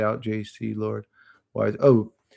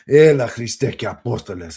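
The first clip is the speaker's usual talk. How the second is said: louder Greek exclamation, imitating dad; dad's Greek oath; 6 dB louder than usual. Quoting what his dad used to say, Greek oath. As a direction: proclaim the Greek like dad cursing. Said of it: "That's what he would say".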